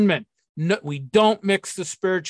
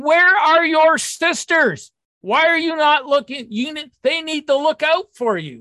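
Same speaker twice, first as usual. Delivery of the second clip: indignant disbelief; 6 semitones higher than usual. Retold or imitated. Indignant at the women's neglect.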